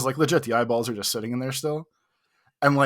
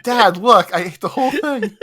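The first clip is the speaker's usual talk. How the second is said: put-on much higher and louder, laughing child voice; proud child; 9 semitones higher, 7 dB louder than usual. Showing the waiter the empty plate, high loud laughing child voice. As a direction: shout it up high like a kid showing off to dad. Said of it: "Like a child".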